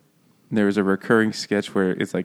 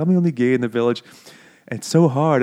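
mock Welsh lament; 3 semitones higher, faster than usual. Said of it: "every time he says that, it's like".

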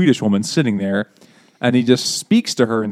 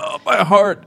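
higher, hammed-up heart attack cry; stricken old man; 8 semitones higher, slower than usual. Old man shocked by news, mock stricken. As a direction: clutch at your heart, melodramatically.

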